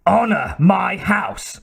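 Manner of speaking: high, outraged cry; a king crying out in disbelief; shrill cry. A narrator's reading. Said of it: "cried Osric shrilly".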